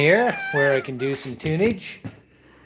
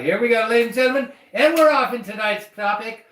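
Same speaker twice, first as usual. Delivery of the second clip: announcer voice; 7 semitones higher than usual. Acted. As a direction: hype the next topic like a game-show host. Show host announces topic.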